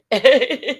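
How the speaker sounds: loud, high laugh through tears; teary laugh. Real, not acted. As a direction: laugh it off after crying. Laughs at herself after crying.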